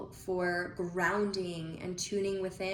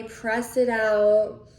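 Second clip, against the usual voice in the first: ordinary talk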